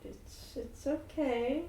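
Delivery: slow, soft reassurance; calm a crying friend gently; soft and slow comfort; gentle soothing. Acted. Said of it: "Shar's like".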